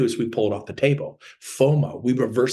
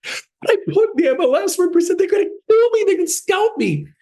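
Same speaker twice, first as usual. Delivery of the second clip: mock panic; 4 dB louder than usual. Mock panic about other agents.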